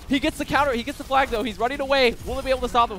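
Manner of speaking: urgent hype caster voice; excited call. Real, not acted. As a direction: pump the tension of the chase. Tense flag chase.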